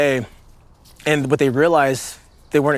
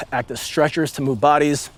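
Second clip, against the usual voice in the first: ordinary delivery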